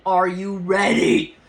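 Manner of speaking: insistent, slow self-challenge; psyching herself up. Retold or imitated. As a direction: stretch it out loudly to rev yourself up. Repeats question to herself, more intensely.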